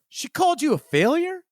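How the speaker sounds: high, indignant mock outrage; incredulous Dignan. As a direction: leap up high defending your friend's honor. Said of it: "And Dignan goes".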